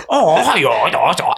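exaggerated mock Chinese, jumping up high; hammed-up imitation of Chinese speech; mock Chinese accent. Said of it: "the Chinese is just like".

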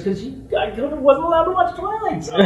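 high, whiny complaint; whiny sister. Retold or imitated. Sister blaming her for the TV ban, high and whiny. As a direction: sulk like a resentful sister.

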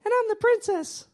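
high, sugary princess voice. Retold or imitated. Pastor acting out a bride, in a high princess voice. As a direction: squeak it up high like a storybook princess.

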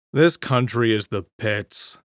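slow, understated grumble; silent fuming. A narrator's reading. Bert grumbles to himself on the platform, quietly fuming. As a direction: fume inwardly, holding it down. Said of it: "he silently fumed".

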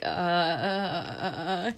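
low, stumped string of "uh"s; imitating someone lost for an answer; unsure response. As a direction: stall on low, halting syllables. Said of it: "not sure how to respond".

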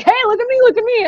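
high-pitched, mock look-at-me call; attention-seeker. Someone coming on the train to be seen, high mock attention-seeker. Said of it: "someone to come on the train and be like".

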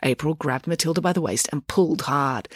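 usual speaking voice

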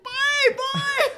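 very high, squeaky-pitched goodbye; mock calling back. Coworker answering a goodbye at the car park, mock high call. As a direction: imitate calling back from afar.

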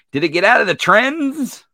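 loud, high, exasperated question. Real, not acted. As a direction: call it out in disbelief.

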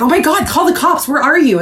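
high, fast alarm; urgent and worried. Retold or imitated. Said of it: "And I was like".

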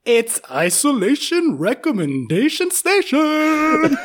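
slow, high game show announcement; announcer voice. Acted. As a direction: boom out the segment title. Announcing segment title.

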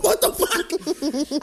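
slow, stretched what the fuck through a laugh; laughing disbelief. Retold or imitated. Holding the door and baffled, slow laughing what the fuck. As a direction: drag it out, cracking up at yourself. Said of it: "I'm just like".

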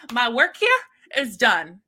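theatrical imitation of a final word; mock-grand sign-off. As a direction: declare it like a hammed-up exit line. Voicing a character's grand exit line.

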